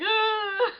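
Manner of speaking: a short, high, loud mock wail of a crying man; imitated wail. Retelling how her date cried on the phone, mock wail. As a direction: copy his sobbing wail, half in jest. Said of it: "But not, like, crying, like".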